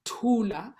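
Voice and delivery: Thula with a strong breathy T; aspirated T in Thula. Shown as a demonstration. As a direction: puff of air on the T of Thula. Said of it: "two t".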